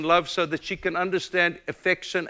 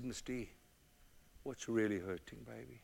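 soft whisper; 4 semitones lower, 14 dB quieter than usual. Retold or imitated. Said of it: "And I whispered".

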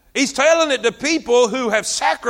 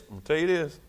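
hushed aside; 7 semitones lower, 9 dB quieter, faster than usual. Shown as a demonstration. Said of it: "Everybody look at me".

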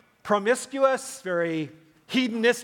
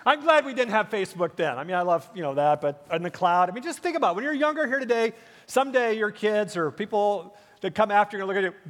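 ordinary talk

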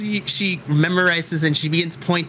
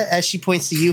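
ordinary delivery

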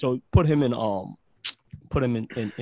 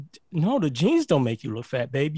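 usual speaking voice

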